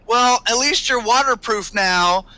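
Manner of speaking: drawn-out remark called from another room; [name]. Acted. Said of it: "you hear [name]'s voice".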